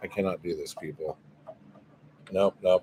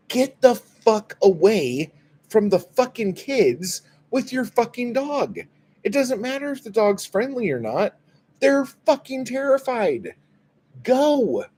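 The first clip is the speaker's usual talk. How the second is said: high-pitched, emphatic telling-off; exasperated outburst; 14 semitones higher, 4 dB louder than usual. Acted. Onlooker ordering the dog owner away from terrified kids, exasperated high outburst.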